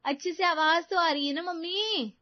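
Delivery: acted loud, high-pitched daughter; loud phone talk. A narrator's reading. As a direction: pipe up high to mom on a call. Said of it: "जोर-जोर से बातें करने लगती है".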